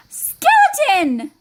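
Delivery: high, drawn-out call; joyful shout. Acted. Goblin spotting Skeleton on the treasure cart, high excited shout. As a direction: call out to a long-lost friend. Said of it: "shouted Goblin".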